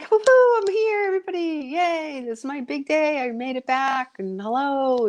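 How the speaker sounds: high, bubbly party greeting; excited cheer. Retold or imitated. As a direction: greet everyone like the guest of honour. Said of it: "all excited I was there".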